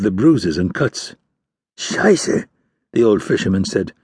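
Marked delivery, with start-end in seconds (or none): 1.8-2.5 whispered, higher curse; startled curse. Acted. Old fisherman cursing under his breath at the bruised corpse.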